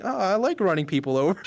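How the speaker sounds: mock nonchalance. Friend imitating a streamer's confession, nonchalantly. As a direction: toss it off lightly, as if harmless. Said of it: "nonchalantly, like, saying".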